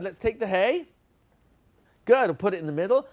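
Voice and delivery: ordinary talk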